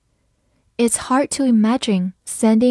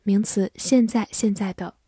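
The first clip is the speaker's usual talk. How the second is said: normal talking voice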